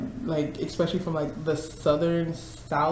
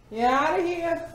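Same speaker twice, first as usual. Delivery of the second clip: high, louder teasing shooing; playful high voice; 12 semitones higher, 4 dB louder than usual. Acted. Teasing coworker who is leaving, high squeaky voice. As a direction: shoo them out in a squeaky voice.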